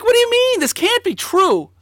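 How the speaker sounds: very high, loud, incredulous squeal; stunned disbelief. Retold or imitated. Teen hearing about the rallies, high-pitched disbelief. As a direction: refuse to believe it. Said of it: "It's like".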